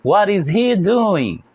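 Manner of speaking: What is he doing, drawn out slowly; slow model question. Shown as a demonstration. Teacher repeats the question for students, slowly. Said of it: "So let's repeat".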